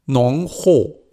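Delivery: ordinary talk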